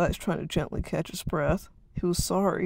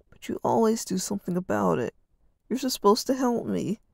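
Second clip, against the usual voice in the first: plain reading voice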